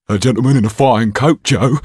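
rat-like thug gloating to his partner, leering. Acted. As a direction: relish the prey like a sneaky rat. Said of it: "leered the rat-like creature".

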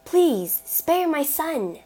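high, drawn-out pleading; desperate mother's plea; gasped plea. A narrator's reading. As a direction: beg for a life, pitched well up. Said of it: "she gasped".

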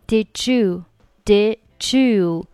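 "did you" fused as "di-joo"; linked reading. Shown as a demonstration. Teacher drilling the option, "did you" fused with a j. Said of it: "连起来读成did you".

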